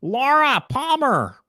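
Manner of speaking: very high, squeezed nerd voice; Steve Urkel. Acted. Doing the director as a sitcom nerd, very high put-on voice. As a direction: push it way up high and hold it there. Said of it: "just do an impression of Steve Urkel".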